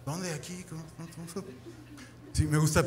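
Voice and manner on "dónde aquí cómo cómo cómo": hushed, sneaky questions, almost breathless. Retold or imitated. Sneaking into a house asking for the girls, hushed. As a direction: ask under your breath like a sneaking intruder.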